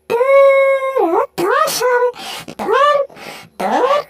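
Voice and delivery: high, excited chase shouting; threatening cartoon chaser.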